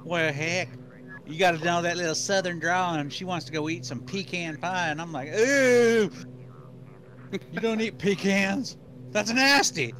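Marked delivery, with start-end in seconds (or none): 5.3-9.9 mock disgust. Mock disgust at pecan pie.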